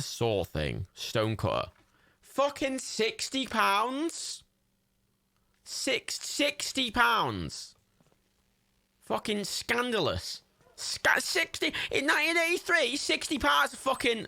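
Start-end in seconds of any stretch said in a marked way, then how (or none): 2.2-7.8 high, slow, drawn-out shock; high squeak of outrage; outraged disbelief. Real, not acted. Outraged at the game's price. 9.0-14.2 high squeaky indignant rant; high, comic, indignant voice; mock outrage. Mock outrage over prices.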